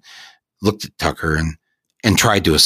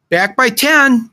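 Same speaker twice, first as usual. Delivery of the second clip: high mom voice calling upstairs; mom shouting; 4 dB louder, slower than usual. Acted. Mom calling to her son upstairs, high and loud. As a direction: call up the stairs as a mom. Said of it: "my mom shouted".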